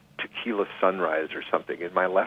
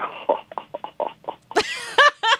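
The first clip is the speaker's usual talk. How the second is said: imitated chuckle. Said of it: "kinda chuckling".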